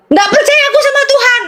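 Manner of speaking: high-pitched mock tantrum at mother; retold angry outburst. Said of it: "udah ngamuk".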